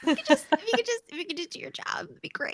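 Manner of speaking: high, fast, fed-up plea; snippy fake-polite ask; exasperated sarcasm. Mocking lazy coworkers.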